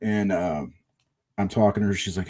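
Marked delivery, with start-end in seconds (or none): none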